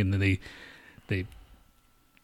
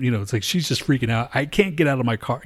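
normal talking voice

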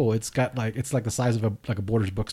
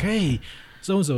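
high-pitched mock call-out; calling someone out; 9 semitones higher, slower than usual. Mimicking someone who outs people on a show, calling out high. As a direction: sing out like an oblivious caller.

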